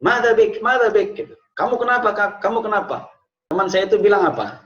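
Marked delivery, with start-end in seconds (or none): 0.0-1.1 high-pitched, urgent acted question; retold concerned questioning, pitched up; friends asking what's wrong. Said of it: "ada yang nanya". 1.5-3.1 high-pitched retold question; imitated concern, voice pitched up; friends asking what's wrong. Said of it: "ada yang nanya".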